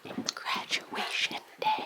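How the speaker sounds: high, slow breathy whisper. Acted. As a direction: whisper it softly. Whispered wake-up call.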